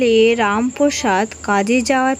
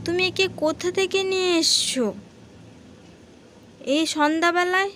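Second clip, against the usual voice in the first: higher, softer wife's voice, questioning; suspicious wife; 7 semitones higher, 8 dB quieter than usual. Acted. Wife asks Ramprasad where the child came from, suspicious. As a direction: ask him where he found this child.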